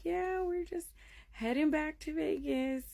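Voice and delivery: high, airy small-talk voice; put-on politeness. Mother gives neighbors a cover story, lightly polite. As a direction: gloss over it in a high polite voice.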